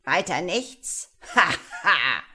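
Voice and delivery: loud, slow scoff into a booming laugh; mock the request, then guffaw; scornful sorcerer's laughter; laughing wizard. A narrator's reading. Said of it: "lachte der Zauberer".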